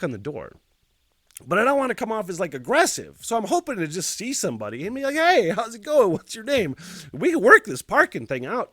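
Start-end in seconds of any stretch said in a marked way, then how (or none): 5.1-8.7 imagined chummy greeting, high and loud; cheerfully raised, neighborly voice; friendly overture. Pretend. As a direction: pitch it as an upbeat, non-aggressive hello. Rehearsing friendly approach to neighbor.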